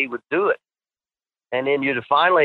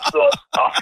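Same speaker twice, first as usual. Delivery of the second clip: higher imitated gagging grunts; mock retching; 10 semitones higher than usual. Partner tricked by the peanut butter, mock retching. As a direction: gag like you're about to puke. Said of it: "And Brown's going".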